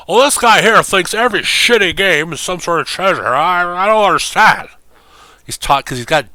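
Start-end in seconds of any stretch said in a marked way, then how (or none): 0.1-4.7 put-on high, loud old-announcer voice; hammed-up Madden, pitched way up; John Madden impression. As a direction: grumble like a confused old football man. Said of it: "bad Madden impressions".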